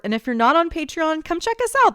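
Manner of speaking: upbeat plug. Acted. Patreon plug, voice rising high.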